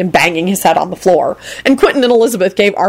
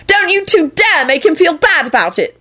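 very high, indignant defence; shouted scolding; 12 semitones higher than usual. A narrator's reading. Woman defending her friend to two others, high mock shout. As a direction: scold them both in a high voice. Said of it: "Elizabeth shouted at Quentin and Lucretia".

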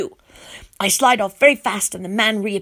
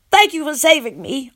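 near tears; 7 semitones higher, 4 dB louder than usual. A narrator's reading. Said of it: "I feel like I want to cry".

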